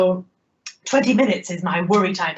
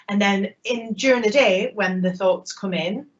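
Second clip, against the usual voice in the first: ordinary talk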